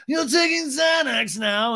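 high, drawn-out imitated screech; hammed-up scandalised cry; mock outrage. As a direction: stretch it out high and appalled. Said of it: "Lisa's like".

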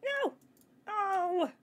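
high, loud yelp of dismay; dismayed cry. Real, not acted. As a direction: cry out as the attempt fails. Fails in the game.

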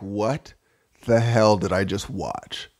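low, slow, dazed disbelief; a stunned viewer leaving the theater; stunned moviegoer. Retold or imitated. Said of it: "walk out of the theater like".

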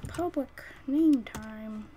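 slow, sing-song drawl; drawn out. Pretend. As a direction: stretch it out slowly. Drawn-out playful phrase.

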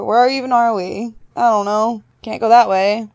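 ordinary talk

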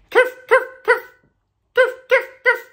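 very high, loud yips; tiny dog yips. A narrator's reading. Reading to kids, yipping like a South African dog. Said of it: "Dogs in South Africa bark".

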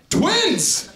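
high, loud burst on one word; loud, high-pitched announcement; exclaimed shout. Acted. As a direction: shout it out in triumph to the crowd. Announcing twins to the audience.

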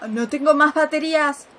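loud panicked outcry; alarmed shout. Real, not acted. Player finding the flashlight battery dead, shouting in alarm. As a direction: yell it as the light runs out. Flashlight dies in horror game.

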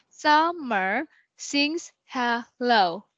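Summer sings hello spelled out slowly; slow syllable count. Shown as a demonstration. Teacher slowly counting syllables for the student. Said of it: "So kindly count".

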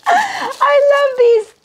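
high, drawn-out squeal of love; overjoyed squeal. Real, not acted. Woman getting long-wanted shoes, overjoyed squeal. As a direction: squeal it slowly, overcome with joy.

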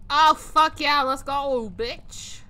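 loud thrilled cheer; excited. Real, not acted. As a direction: hype yell of triumph. Excited over ghost interaction.

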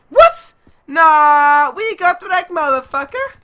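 loud squeal, then drawn-out protest; high-pitched yell. Real, not acted. Gamer dying in the match, voice shooting up high. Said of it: "getting higher and higher every time we die".

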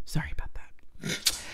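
soft unvoiced whisper. Real, not acted. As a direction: breathe the words out with no voice. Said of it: "Are we talking like this now?".

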